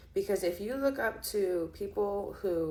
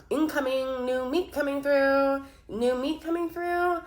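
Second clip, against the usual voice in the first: higher, louder hallway call; mock announcement; 7 semitones higher, 4 dB louder than usual. Reader acting the movie boy announcing the new girl, higher and louder. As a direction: announce it like clearing a crowded hall. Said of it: "And he's like".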